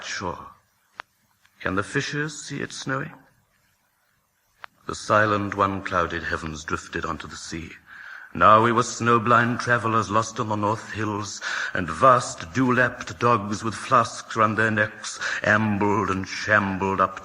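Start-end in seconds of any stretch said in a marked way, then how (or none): none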